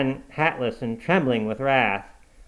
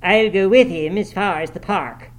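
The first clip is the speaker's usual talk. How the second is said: louder, higher character voice; young man volunteering; 7 semitones higher, 6 dB louder than usual. A narrator's reading. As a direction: speak up to offer an escort. Young man offers help, acted.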